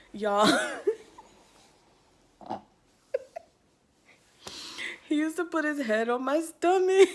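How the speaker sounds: loud, high, laughing squeal; cracking up. Real, not acted. Storyteller cracking up over boyfriend's head on stomach, real laughing. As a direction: burst out laughing, then squeal it out.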